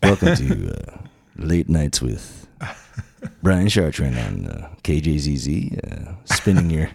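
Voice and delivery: low late night DJ voice; warm announcer. Shown as a demonstration. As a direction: croon it like a late night jazz DJ. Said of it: "This is my podcast voice".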